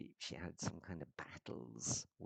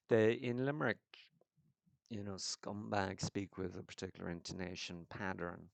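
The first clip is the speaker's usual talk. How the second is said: ordinary talk, nothing marked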